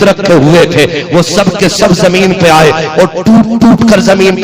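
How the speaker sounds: building from lively telling to a high, loud ringing cry; preaching crescendo. Acted. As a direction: climb step by step to a soaring climax. Climactic retelling, rising to a cry.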